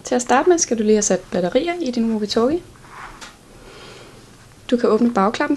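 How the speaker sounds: ordinary talk, nothing marked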